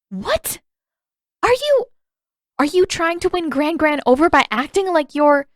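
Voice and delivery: incredulous Katara. A narrator's reading. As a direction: stammer out your outrage.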